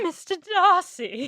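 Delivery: hammed-up British accent on "Mister Darcy"; mock British accent. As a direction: drawl it out in a posh British put-on. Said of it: "you just went, "Mister Darcy".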